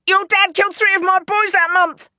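high, loud pained comeback; defensive retort. Acted. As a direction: fire back at her, cornered. Gangster snaps back at girl.

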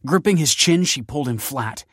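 ordinary delivery

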